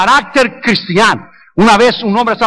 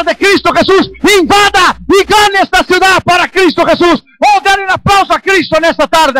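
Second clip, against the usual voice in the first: preaching at full force; 6 semitones higher than usual. Real, not acted. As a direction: charge the church to conquer the valley. Preaching climax.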